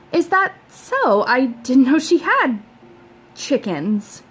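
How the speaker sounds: mom's slow suspicious question; doubting mother. A narrator's reading. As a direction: draw it out with doubt.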